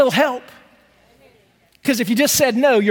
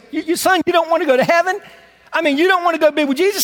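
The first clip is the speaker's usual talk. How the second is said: higher, incredulous preacher voice; preacher's disbelief; 6 semitones higher, faster than usual. Retold or imitated. Preacher asking the boy in disbelief, higher and incredulous. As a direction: ask the boy in shocked disbelief. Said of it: "he looks at the little boy and he goes".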